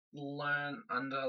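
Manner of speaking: ordinary delivery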